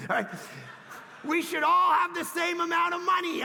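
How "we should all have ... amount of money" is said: loud, high mock protest; mock demand. Preacher parodying socialist fairness, loud and high. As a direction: parody the demand for equal money.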